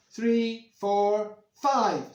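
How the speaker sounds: loud, high counting aloud; grandad's voice. Acted. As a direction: call out each chime as grandad. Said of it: "says Grandad".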